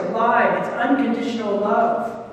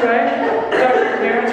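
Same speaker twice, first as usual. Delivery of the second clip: normal talking voice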